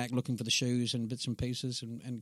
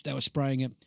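ordinary delivery

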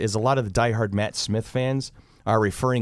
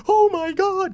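very high, louder imitation of swooning fans; mock fandom squeal; 5 dB louder, much slower than usual. Fan imitating the fandom's reaction, high excited squeal. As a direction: squeal it like a whole fandom at once. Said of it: "entire fandom of Doctor Who just goes".

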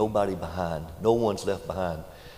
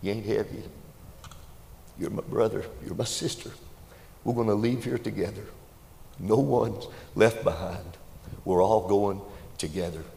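preaching appeal. Acted. Preacher applies story to congregation.

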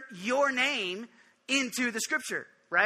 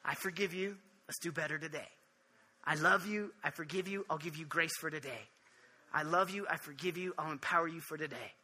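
soft, low, tender reassurance with pauses; hushed and kindly, falling softer on each phrase; gentle; 4 semitones lower, 7 dB quieter than usual. Acted. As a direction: speak forgiveness quietly, as a loving God. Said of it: "he just gently says".

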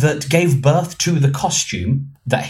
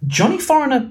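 high-pitched excited realisation; 12 semitones higher than usual. Real, not acted. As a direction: let sudden excitement lift your voice right up. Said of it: "I just got very excited".

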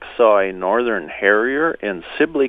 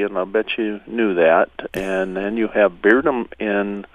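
usual speaking voice

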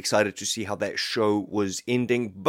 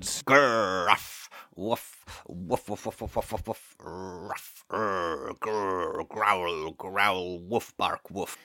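lower, softer growls and barks; dog growling; 3 semitones lower, 3 dB quieter, slower than usual. Acted. Detective dog telling the thief off, lower growling barks. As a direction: growl like a dog guarding its catch.

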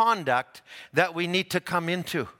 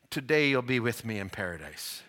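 tender promise; 5 semitones lower, 5 dB quieter than usual. Retold or imitated. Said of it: "he reaches over".

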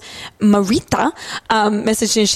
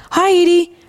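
friendly host greeting; 8 semitones higher, 4 dB louder than usual. Retold or imitated. Hamish greeting child.

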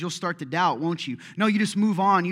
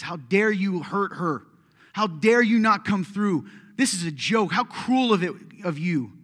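angry yell at God; 3 semitones higher, 6 dB louder than usual. Pretend. Grieving believer rebuking Jesus for not coming, angry shout. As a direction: hurl the words at Jesus, betrayed.

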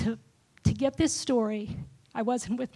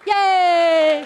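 loud, high, drawn-out cheer; proud, full-voiced celebration; big cheer; 8 semitones higher, 11 dB louder than usual. Real, not acted. Cheers her boys as they stand.

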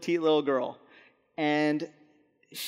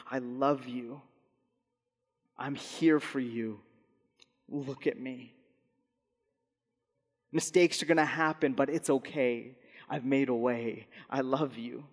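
tender divine voice; 3 semitones lower, 5 dB quieter than usual. Pretend. God's voice hovering over us, softly reassuring. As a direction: whisper love to someone hurting.

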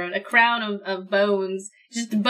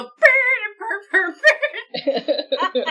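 high, loud horn noise; horn imitation; 10 semitones higher, 4 dB louder, much slower than usual. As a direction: buzz like a shawm. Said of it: "you literally go".

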